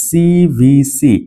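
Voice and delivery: very slow, stretched CVC; CVC said slowly, each syllable held; drawn out. Shown as a demonstration. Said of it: "এখানেও একই ঘটনা ঘটেছে".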